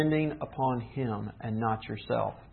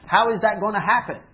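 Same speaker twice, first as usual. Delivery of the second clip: mock worry; 7 semitones higher, 5 dB louder than usual. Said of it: "That's concern".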